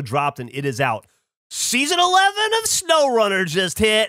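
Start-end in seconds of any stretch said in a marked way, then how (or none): 1.5-4.1 high, hyped-up announcement; thrilled gamer hype, pitched way up; real excitement. Real, not acted. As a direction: burst out with big news, stretching the key word. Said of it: "I'm so fucking excited to play".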